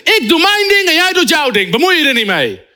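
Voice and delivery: high, brushing-off reply to a heckler; preacher snapping back at heckler; sharp retort. Retold or imitated. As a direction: shut down the heckler.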